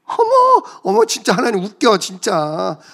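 a woman tittering in disbelief; giggling Sarah. Acted. Sarah laughs behind the door. (높은 목소리로 깔깔대는 사라)

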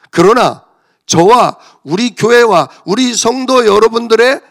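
preaching at full force. Acted. Pastor declaring Christ's sufficiency to the church, loud proclaiming.

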